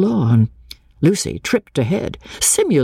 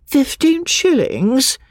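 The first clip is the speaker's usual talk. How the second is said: slow, doubtful question rising high; dubious; 13 semitones higher, slower than usual. Acted. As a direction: ask it as if you cannot believe the sum. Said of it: "said Miss Bartlett dubiously".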